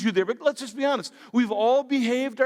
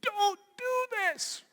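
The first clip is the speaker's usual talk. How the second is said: high, slow pleading warning; slow high cautioning voice; stretched high plea; 11 semitones higher, 5 dB quieter, much slower than usual. Retold or imitated. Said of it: "somebody was saying".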